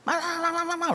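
high-pitched babbled tirade; mock Korean yelling. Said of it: "I started yelling at him in Korean".

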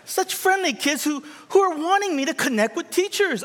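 high-pitched mock-innocent gushing; naive delight. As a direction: gush like a wide-eyed newcomer, voice way up. Naive childhood thought, acted out.

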